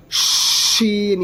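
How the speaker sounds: شین with a long hissing sh at the start; whistling sound. Shown as a demonstration. Teacher shows sixth letter, long whistling شین. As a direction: stretch the sh of شین like a whistle of air. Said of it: "یہ جو آپ سیٹی کی آواز سن رہے ہیں".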